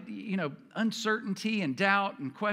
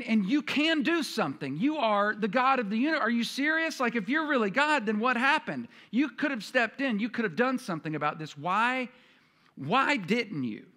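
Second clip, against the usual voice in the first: angry accusing prayer; 4 semitones higher than usual. Retold or imitated. Frustrated believer accusing God after unanswered prayer, raised and angry. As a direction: demand answers from God, hurt and frustrated. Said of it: "you're getting angry".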